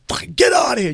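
high, loud dismissive yell; angry shout. Retold or imitated. Cop giving up on Patrice, high angry shout. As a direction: wave him off with a yell. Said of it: "the guy, he goes".

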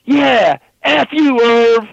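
high, loud, cracking; cocky mock yell. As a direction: jump in the fight to show off. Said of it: "I'm just like".

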